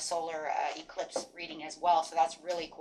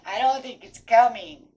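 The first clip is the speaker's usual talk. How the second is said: imitating crying; 4 semitones higher, 9 dB louder than usual. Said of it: "some days I'm crying, going".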